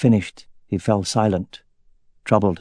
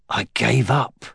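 ordinary delivery

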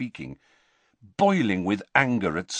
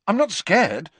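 put-on sharp, higher, louder boy's retort; quick denial; 11 semitones higher, 5 dB louder than usual. A narrator's reading. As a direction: fire it back at once. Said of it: "said Harry at once".

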